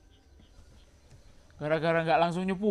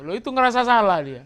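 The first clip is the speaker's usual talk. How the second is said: emphatic preaching; 6 semitones higher, 10 dB louder than usual. Real, not acted. Preacher stresses the point.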